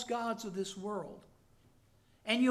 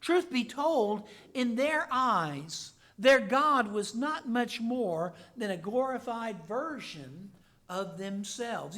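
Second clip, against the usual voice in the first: ordinary talk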